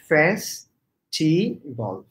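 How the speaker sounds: "festival" as fes, pause, ti, bol; teacher's syllable-by-syllable "festival"; broken into syllables. Shown as a demonstration. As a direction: chop "festival" into slow separate syllables. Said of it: "তুমি একটু ভেঙে ভেঙে বলো".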